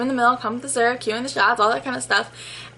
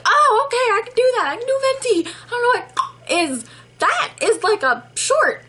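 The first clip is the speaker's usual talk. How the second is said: very high, mock-incredulous outburst; squeaky retold exasperation; exasperated squeal; 12 semitones higher, 3 dB louder than usual. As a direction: pitch it way up in comic disbelief. Exasperated reaction to unclear order.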